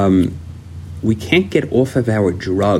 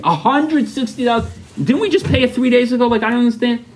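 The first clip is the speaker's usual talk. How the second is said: high, incredulous complaint; indignant; 13 semitones higher than usual. Retold or imitated. Husband to wife over the cleaning lady, indignant. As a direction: protest the bill in mock outrage.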